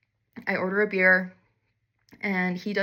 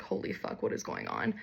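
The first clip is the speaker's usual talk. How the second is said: strangled, much lower, breathless voice; choking gasp; 13 semitones lower, 8 dB quieter than usual. Retold or imitated. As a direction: croak it out as if tequila fills your throat. Choking on this tequila.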